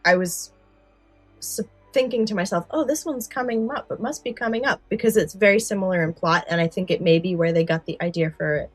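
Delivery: ordinary talk, nothing marked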